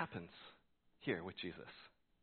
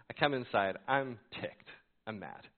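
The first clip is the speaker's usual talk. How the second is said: usual speaking voice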